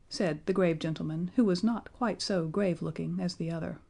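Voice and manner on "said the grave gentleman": ordinary delivery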